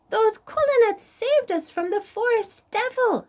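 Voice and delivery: tiny ant voice. Acted. Ant greeting Koi in a tiny high voice. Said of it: "said the ant".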